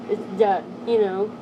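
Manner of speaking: ordinary talk, nothing marked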